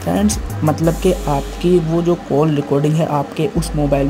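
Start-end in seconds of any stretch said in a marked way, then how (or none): none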